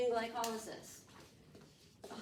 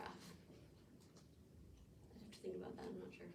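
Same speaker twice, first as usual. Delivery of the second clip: very soft, lower voice; hushed, trailing musing; unsure thinking aloud; 4 semitones lower, 18 dB quieter, much faster than usual. Real, not acted. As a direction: admit uncertainty half to yourself. Unsure, thinking aloud over a question.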